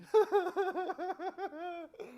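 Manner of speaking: high, rapid run of laughter; helpless wheezy cackle; real laughing fit. Real, not acted. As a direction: crack up all over again at your joke. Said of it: "That shit was funny to me".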